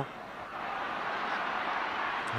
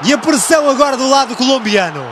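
raised, animated play-by-play; excited commentary; 7 semitones higher, slower than usual. Real, not acted. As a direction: keep the goal excitement going. Post-goal commentary.

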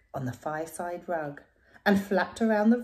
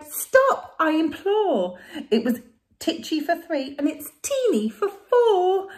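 high, loud desperate cry; 9 semitones higher, 8 dB louder than usual. Acted. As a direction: cry out, nearly sobbing. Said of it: "The little old lady cried".

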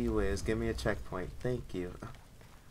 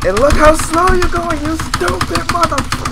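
loud high angry insult; furious yell; 10 semitones higher, 11 dB louder than usual. Real, not acted. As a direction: rage at the character on screen. Raging at slow character.

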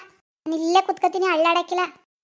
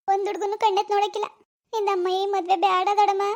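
pleading niece; 5 semitones higher than usual. Acted. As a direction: plead with the aunt, near tears. Girl begs aunt against marriage.

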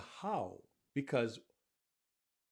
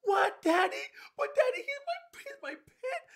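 put-on much higher, loud, tearful little boy; six-year-old kid; 6 dB louder than usual. Shown as a demonstration. Boy learning his pet died, very high and pleading. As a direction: plead like a heartbroken child. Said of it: "the voice is different for a six or eight-year-old kid".

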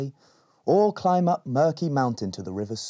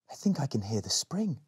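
hushed whisper; 3 semitones higher, 6 dB quieter than usual. Acted. Rowan listens in cave.

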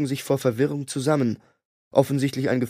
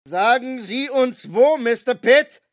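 much higher, slow yelled demand; hammed-up interrogator shout; Myers shouting; 11 semitones higher, 4 dB louder, slower than usual. A narrator's reading. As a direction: drag the answer out of him. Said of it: "schrie Myers mich an".

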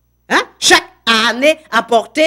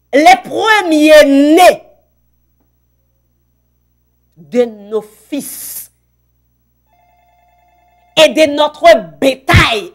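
slow solemn reading; 4 semitones higher, 6 dB louder, much slower than usual. Acted. Lecture lente et solennelle.

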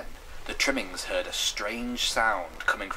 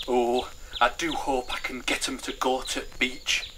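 slow, higher, eager wish; eagerly hopeful, pitched up; 4 semitones higher than usual. Acted. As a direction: draw it out with eager hope. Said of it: "she said eagerly".

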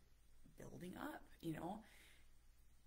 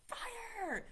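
much higher alarmed shout; panicked cry. Retold or imitated. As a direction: yell it like a frightened kid. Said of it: "would get so worked up".

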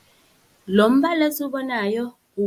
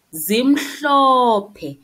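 loud announcement; 3 semitones higher, 10 dB louder than usual. Shown as a demonstration. Teacher gives answer.

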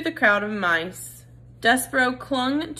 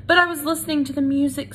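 quick, higher plea; eager plea; 7 semitones higher, 4 dB louder, much faster than usual. A narrator's reading. Despero defends himself to the council, eager quick plea. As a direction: hurry to explain.

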